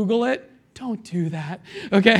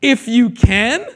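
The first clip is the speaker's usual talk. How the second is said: slow, louder, rising disbelief; incredulous echo; 3 semitones higher, 6 dB louder, slower than usual. Retold or imitated. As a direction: echo it back in mock disbelief. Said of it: "Jesus answered this man".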